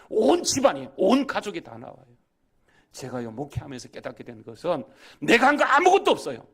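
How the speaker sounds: loud, high, surging pulpit exhortation; impassioned preacher hammering key phrases; full-force preaching. Real, not acted. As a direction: proclaim it fervently to the congregation.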